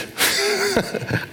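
laugh. Real, not acted. Amused at understatement.

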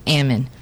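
almond dropped low as "AM-un", like salmon; low-pitched almond rhyming with salmon; rhymes with salmon. Shown as a demonstration. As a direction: say almond with a short a and no L. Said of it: "Think salmon".

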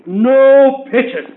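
loud, slow mock scream of no; retold banshee scream. Agent retelling the singer's refusal, mock banshee scream. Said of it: "she screams like a banshee".

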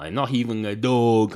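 ordinary delivery

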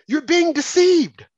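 high, slow, insistent warning; impassioned warning. Real, not acted. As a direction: drive it home slowly, pitch way up. Heated warning at climax of rant.

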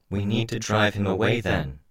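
high, eerie insect-made voice; voice through bugs. A narrator's reading. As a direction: speak as if through a swarm. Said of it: "I spoke through my bugs".

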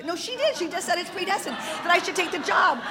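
high, rapid overriding protest; excited insisting. Retold or imitated. Wife overruling the fortune teller's denial, excited insisting.

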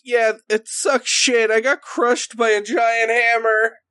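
high, slow injured creature voice; high-pitched wounded goblin grumbling; Derek voice. Acted. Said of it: "and he goes".

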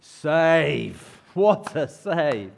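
loud, drawn-out cheer for a save; big, slow shout of acclaim; admiring shout. Acted. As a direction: roar it like delighted football fans. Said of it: "they all shouted".

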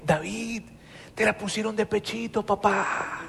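más suave, en tono cómplice y con una risa; le susurra la tentación al oído; tentación en voz baja. Acted. Los hombres tientan a David. (compañero cómplice que lo tienta en voz baja)

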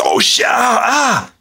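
high mock yelp of pain; retold scream. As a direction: imitate a friend crying out in pain. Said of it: "he screams every time I pull".

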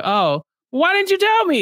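high-pitched, hurt questioning; hammed-up wounded plea; mock dismay. Host acting out a betrayed player questioning an ally, mock dismay.

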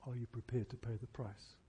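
normal talking voice

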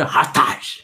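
mock-German Hartage, a harsh "ha-TAHZH"; German pronunciation. Shown as a demonstration. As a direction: bite out the surname like a quarrelling German. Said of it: "Se ele é alemão".

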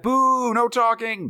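booing playfully; mock boo. Real, not acted. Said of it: "And I say to that".

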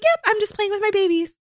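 put-on little girl voice. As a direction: pitch up into a bright kid voice. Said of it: "She's like".

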